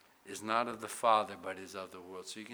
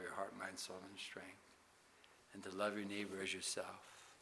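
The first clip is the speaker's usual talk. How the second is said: soft reflective preaching; 3 semitones lower, 11 dB quieter than usual. Acted. Quiet reflection on Christ's love.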